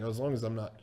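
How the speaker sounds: quick, very soft, low aside; hushed, hurried thinking aloud; inner thought. Retold or imitated. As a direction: mutter it privately to yourself. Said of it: "in my head, I'm like".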